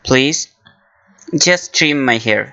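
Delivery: very slow, careful English; slow model phrase. Shown as a demonstration. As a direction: say each word slowly for learners. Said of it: "আমার চুলগুলো পরিপাটি করে দিন।". (ধীরে ধীরে স্পষ্ট উচ্চারণ)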